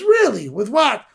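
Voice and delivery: high-pitched, astonished questioning; disbelieving sister. Retold or imitated. Sister asking her little brother how he paid, incredulous. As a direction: imitate a sister who can't believe it.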